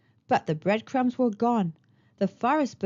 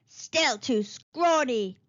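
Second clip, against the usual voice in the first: slow witch; slower than usual. Acted. Witch feels the bone.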